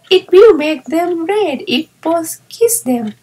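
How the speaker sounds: eager young girl playing in the snow; child delighted with her new playmate; little girl Violet. A narrator's reading. Violet speaks in story.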